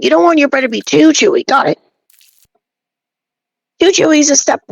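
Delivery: usual speaking voice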